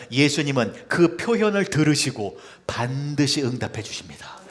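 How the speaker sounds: usual speaking voice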